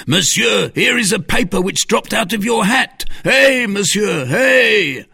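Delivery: high, loud shout after someone; calling out. Acted. Hostler calling after a departing stranger, shouting. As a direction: shout to catch him before he's gone. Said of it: "cried a hostler".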